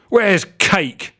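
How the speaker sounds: high yelled "Where's Cake". A narrator's reading. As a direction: call out angrily for Cake. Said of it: "yelled Blodgett".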